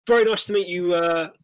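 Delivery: bright welcome, pumping a hand; enthusiastic host. Acted. Host Jed greets Craster with a light hint of eager welcome. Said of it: "rather enthusiastically shakes your hand".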